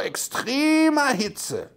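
EXTREMER drawn far out, high-pitched; mock alarm headline. Host mocking a heat-wave headline, drawn-out mock alarm.

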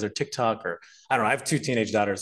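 usual speaking voice

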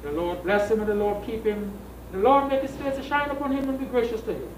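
normal talking voice

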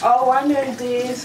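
normal talking voice

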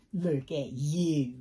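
slow, low, tender coo. Acted. Cooing at doll.

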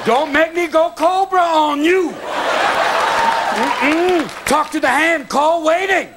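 put-on high sassy girl voice warning a man off; sassy woman. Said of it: "send a girl down there going".

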